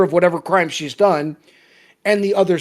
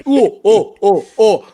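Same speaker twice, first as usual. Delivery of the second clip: slow, louder fake Russian accent gibberish; mock Russian accent; 5 dB louder, slower than usual. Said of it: "I'm not letting the Russian off the hook".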